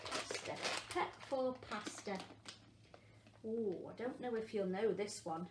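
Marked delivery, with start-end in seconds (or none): none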